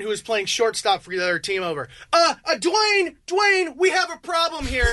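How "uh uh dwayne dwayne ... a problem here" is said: high, drawn-out mock call for backup; wife calling husband. Wife calls her husband over, high drawn-out complaint. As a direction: imitate her summoning him, slow and raised. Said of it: "she called her husband".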